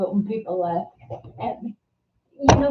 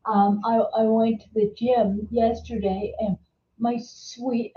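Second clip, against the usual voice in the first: usual speaking voice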